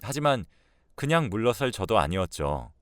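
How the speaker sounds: plain reading voice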